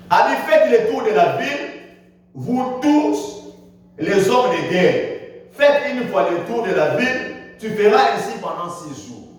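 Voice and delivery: ordinary talk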